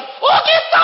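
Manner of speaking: very high, loud comic cry; shouted amazement. Retold or imitated. Man seeing again once glasses come off, high amazed shout. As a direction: yell it in sudden revelation.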